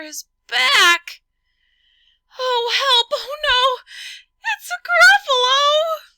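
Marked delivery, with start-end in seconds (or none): 2.4-6.1 high, loud terrified shriek; terrified cry. Acted. Mouse crying out in terror at the Gruffalo. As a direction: scream for help.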